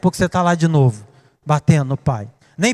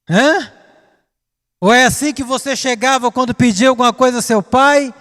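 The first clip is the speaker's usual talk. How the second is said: usual speaking voice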